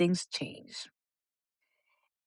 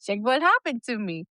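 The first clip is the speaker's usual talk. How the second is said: laughing quote; 5 semitones higher than usual. Retold or imitated. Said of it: "this is my way of saying".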